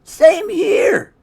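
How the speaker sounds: Wilson's voice. Acted. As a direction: squeak it out high and bright. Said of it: "said Wilson".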